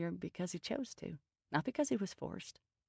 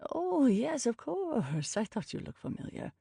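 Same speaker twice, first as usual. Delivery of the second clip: put-on high, lilting British Indian accent; sudden polite recognition in a British Indian lilt; 7 semitones higher, 3 dB louder than usual. A narrator's reading. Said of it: "in a lilting British Indian accent".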